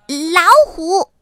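high, loud little-fox shout; loud cry. A narrator's reading. Little fox announcing tigers to mama, shouting. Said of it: "小狐狸大声喊".

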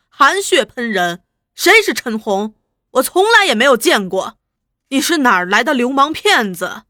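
loud, indignant scolding; angry rebuke. Acted. Prisoner denounces informer in open court, angry rebuke. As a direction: denounce the slanderer loudly. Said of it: "怒声斥责道".

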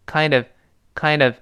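KIND OF joined as "kain-dəv"; one-word "kind of" with a reduced vowel; linked reading. Shown as a demonstration. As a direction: run the d of "kind" into a weak "of". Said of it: "Kind跟of连读".

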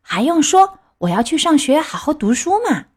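Pinocchio. A narrator's reading. Pinocchio answers the fox about school, high and eager. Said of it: "皮诺乔回答说".

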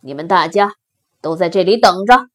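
low lion voice giving orders; commanding lion. Acted. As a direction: order the herd to wait, low and firm. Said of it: "狮子说". (低沉有力的狮子命令)

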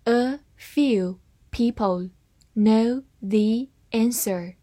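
slow, careful reading of "A few people know the answer"; slowed-down model sentence. Shown as a demonstration. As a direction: read it slowly. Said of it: "慢慢来读".

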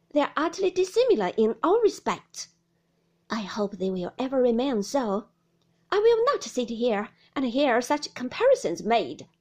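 loud indignant tirade; angry, emphatic scolding; in a passion. A narrator's reading. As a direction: declare your outrage at full volume. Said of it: "into a passion".

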